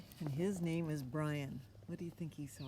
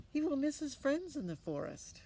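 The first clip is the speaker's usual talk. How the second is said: much higher mother voice; mother's worry; 10 semitones higher, 4 dB louder than usual. Acted. Mother warning her son, much higher voice. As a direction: voice the fretting mom up high. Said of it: "Mother, of course, said".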